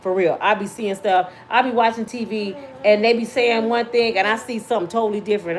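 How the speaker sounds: ordinary delivery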